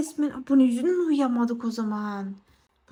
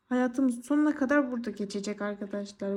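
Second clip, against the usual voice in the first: normal talking voice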